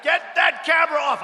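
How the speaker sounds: high, loud imitation of a screamed command; mock screamed order. Imitating bosses screaming at cameramen, high and loud. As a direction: act out a boss yelling an order. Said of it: "They're screamed at".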